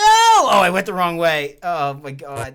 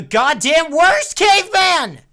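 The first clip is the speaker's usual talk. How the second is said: shouting; 9 semitones higher, 6 dB louder, slower than usual. Real, not acted. Losing the game, yelling.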